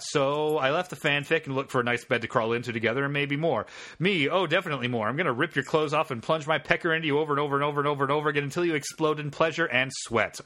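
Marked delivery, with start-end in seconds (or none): 4.3-10.4 fast breathless run-on; rushed. Acted. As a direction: race through the whole line. Rushed reading of explicit line.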